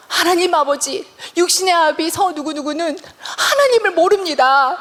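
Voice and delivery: high, slow pleading prayer, re-enacted; fervent prayer. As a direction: cry out to God for your father. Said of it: "기도를 하기 시작하는". (높고 간절하게 부르짖는 기도)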